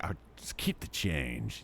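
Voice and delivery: cool and casual. Retold or imitated. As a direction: toss it off like a big spender. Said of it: "I'm like".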